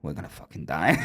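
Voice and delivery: low, hushed mock doom; winding him up. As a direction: drop low and soft to tease a scared friend. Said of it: "I'm just winding him up".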